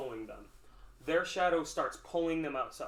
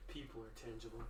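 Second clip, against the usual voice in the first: hushed, lower character voice; dark voice; 4 semitones lower, 16 dB quieter, faster than usual. Acted. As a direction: drop it low and very soft, darkly. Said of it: "In his dark voice".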